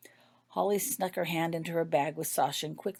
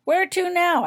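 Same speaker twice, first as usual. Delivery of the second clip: cheery cabbie; 11 dB louder than usual. A narrator's reading. Cabbie asks passengers.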